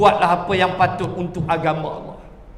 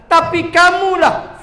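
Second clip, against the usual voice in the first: Satan scolding; 7 semitones higher, 4 dB louder than usual. Acted. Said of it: "Li tu aku, setan lah".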